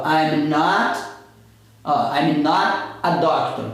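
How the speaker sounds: slow, careful "not a doctor" with a sounded T; deliberate, word-by-word "I'm not a doctor"; unlinked words. Shown as a demonstration. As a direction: keep "not" apart from "a doctor". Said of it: "Soltou o T".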